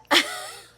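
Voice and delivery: high, loud burst of laughter. Real, not acted. Laughing at her own stubborn meditation anger. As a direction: crack up at yourself.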